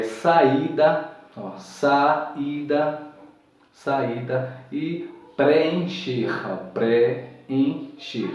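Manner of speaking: saída and preencher very slowly, vowels pulled apart; syllable by syllable. Shown as a demonstration. Teacher stretching saída and preencher slowly. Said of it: "ficam em sílabas diferentes".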